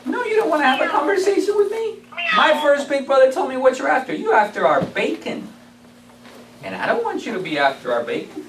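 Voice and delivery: a small pig firmly refusing the wolf; defiant little pig. Acted.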